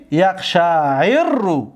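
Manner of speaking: یقشعر رو drawn out as yaq-shaa-'irru, r's joined; merged double r. Shown as a demonstration. Teacher answering what merging gives, یقشعر رو slow with merged r.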